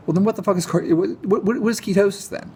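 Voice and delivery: rising incredulous rant, pitch going up and up; exasperated outburst. Real, not acted.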